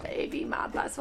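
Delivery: hushed whisper. Pretend. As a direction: breathe it out secretly. Repeats pitch in whisper.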